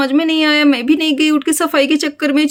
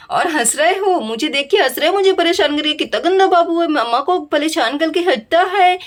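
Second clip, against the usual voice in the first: put-on higher, sing-song cooing to a baby; baby talk; 3 semitones higher than usual. Mother cooing at the baby who laughs at her. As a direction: tease the baby in fond baby talk.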